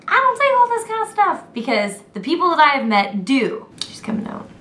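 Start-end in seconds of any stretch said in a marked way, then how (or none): 0.1-1.4 mock indignant Australian. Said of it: "don't be like". 3.9-4.5 hushed whisper so the roommate won't hear; whispering as the roommate comes out. Real, not acted. Roommate walks in during filming.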